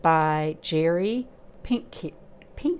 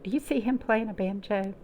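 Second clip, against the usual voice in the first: usual speaking voice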